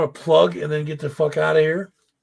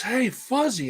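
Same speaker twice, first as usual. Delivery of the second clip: put-on higher, sing-song baby voice; high teasing greeting; 8 semitones higher, 4 dB quieter, slower than usual. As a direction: greet him like a teasing toddler. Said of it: "he goes".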